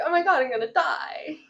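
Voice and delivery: joking panic with a laugh; giggly exaggerated dread; laughing mock terror. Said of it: "and you're like".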